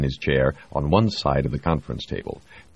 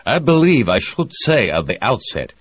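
higher, louder formal Russian voice; Russian official; 8 semitones higher, 6 dB louder than usual. A narrator's reading. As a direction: stiff Soviet delegate.

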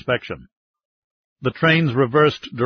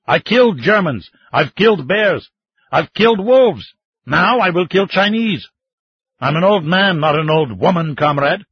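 higher, louder proud boasting; defiant boast; 5 semitones higher, 4 dB louder than usual. Acted. Old man to the mining chief, loud proud defiance. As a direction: hurl each kill at him like a trophy.

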